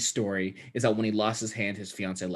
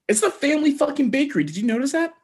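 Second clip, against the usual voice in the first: excited; faster than usual. Real, not acted. Emphatic outrage about the bakery.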